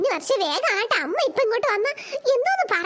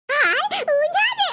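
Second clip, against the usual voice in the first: slow, laughing high cry of joy; delighted squeal; 5 semitones higher, much slower than usual. Acted. Grandmother delighted at the swing, laughing squeal. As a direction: squeal with glee, drawn out.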